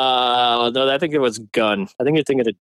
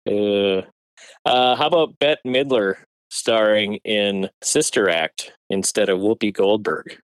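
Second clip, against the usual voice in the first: usual speaking voice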